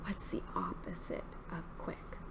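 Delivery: quick, unvoiced whisper. Shown as a demonstration. Quizzing children on the opposite of quick, whispered. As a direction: whisper it low and fast. Hushed quiz question to children.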